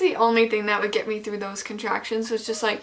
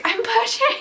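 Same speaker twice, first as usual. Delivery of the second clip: high, urgent cry; pitched-up panicked shout; yelling to husband that she's pushing; retold yell; 12 semitones higher than usual. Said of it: "I yell to him".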